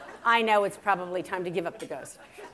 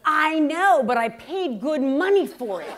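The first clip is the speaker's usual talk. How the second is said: higher, louder mock outrage from mom; loud indignant protest; 5 semitones higher, 9 dB louder, slower than usual. Mother defending her old colander, loud indignant protest. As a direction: push back hard, comically aggrieved.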